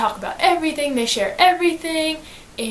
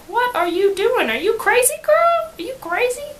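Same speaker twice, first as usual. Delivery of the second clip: shocked disbelief; 9 semitones higher than usual. Retold or imitated. Best friend Phoebe scolding Janie.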